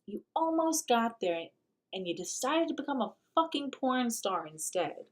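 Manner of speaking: normal talking voice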